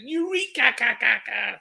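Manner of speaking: high, echoing game-show shout; high mock catchphrase with echo; George Dawes catchphrase. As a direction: bellow it like the big baby host. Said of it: "What did he used to say?".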